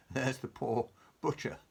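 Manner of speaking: ordinary delivery, nothing marked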